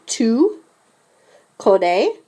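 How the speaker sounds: Tu, a pause, then connais rising; split for repetition. Shown as a demonstration. Teacher has the class repeat Tu connais in parts. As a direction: break Tu connais into two slow pieces. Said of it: "s'il vous plaît, répétez".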